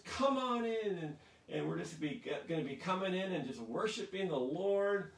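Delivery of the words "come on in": high, raised-voice call to come in; jubilant welcome. Acted. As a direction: beckon the whole crowd inside. Voicing heaven's welcome to everyone.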